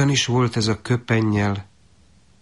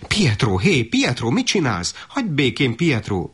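higher, sharp mother's rebuke; scolding mother; 6 semitones higher than usual. Acted. Mother scolding Pietro for tugging the cloak, higher and sharp. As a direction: snap at the little brother to stop. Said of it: "pirított rá az anyjuk".

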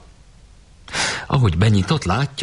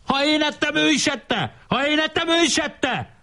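very high, squealed schoolboy protest; hammed-up indignant squabbling; acted angry protest. A narrator's reading. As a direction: chant the accusation in a high voice. Acted squabble, boy repeats accusation.

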